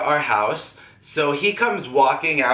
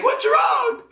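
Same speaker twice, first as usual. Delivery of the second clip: much higher mock-mom voice; mock worried mom. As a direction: play an anxious mother, pitched way up. Mom asks what's wrong.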